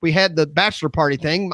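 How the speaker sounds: normal talking voice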